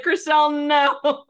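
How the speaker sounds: horrified no. Retold or imitated. Podcaster reliving yelling at Chrishell, high and anguished. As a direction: cry out as if to stop her. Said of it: "And I was like".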